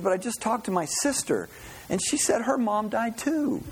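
high-pitched mock-upset woman; upset blonde. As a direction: play a hammed-up upset employee in a joke. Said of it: "she is again just crying and, and very upset".

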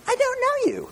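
very high mock squeak; mocking squeaky spirit. Preacher mocking how an evil spirit wouldn't sound, very high. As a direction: squeak it like a silly cartoon spirit. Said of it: "I just don't see an evil spirit going".

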